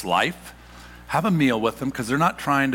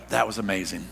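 mild fond recollection; 4 semitones lower than usual. Retold or imitated. Quoting family looking back.

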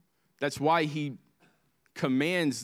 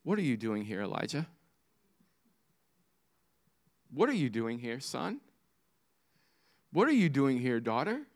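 soft, low, gentle question; loving father; 3 semitones lower, 4 dB quieter, faster than usual. Acted. Loving father to his heartbroken child, soft and tender. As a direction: ask it quietly, as a loving father. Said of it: "the loving father in our heartache says to us".

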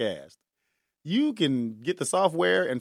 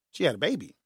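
ordinary talk

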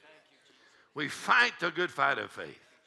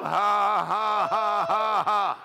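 slow, separate "ha" bursts; declamatory forced laughter; deliberate faith laugh; 6 semitones higher, 5 dB louder, much slower than usual. Shown as a demonstration. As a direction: laugh on purpose, one loud ha at a time. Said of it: "Now laugh about it".

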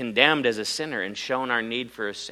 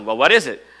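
ordinary talk

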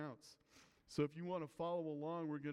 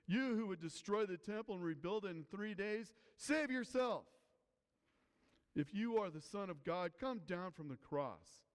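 higher, forceful taunt peaking on "save yourself"; derisive jeer; 5 semitones higher than usual. Acted. Passers-by jeering at Jesus on the cross, derisive. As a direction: call it out like a heckler deriding him. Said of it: "those who passed by derided him".

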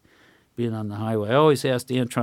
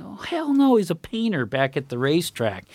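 high-pitched, louder retort, acting it out; mock emphatic denial; 7 semitones higher, 3 dB louder than usual. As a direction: blurt out a hammed-up "no way". Retold indignant denial to a cashier.

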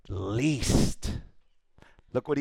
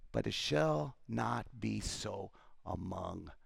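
hushed; 6 semitones lower, 10 dB quieter, slower than usual. Acted. Jesus's words dropped to near hush.

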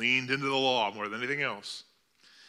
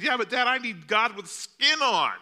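put-on high child voice, louder; boy pleads with his dad; little boy begging for comfort; pleading child; 10 semitones higher, 5 dB louder than usual.

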